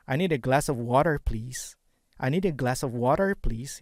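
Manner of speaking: I need a glass of water said American, with a rhotic r in water; flat American a and r-coloured water; American accent. Shown as a demonstration. Teacher demos to students, American accent. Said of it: "Itu American".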